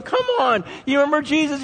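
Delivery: high-pitched heckling; mocking. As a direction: call him out like a scoffer. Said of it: "people are mocking him".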